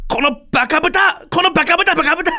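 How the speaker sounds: high re-enacted shout at himself; loud self-scolding. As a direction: berate yourself in a high outburst. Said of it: "思わず大きな声で". (大声で自分を罵る)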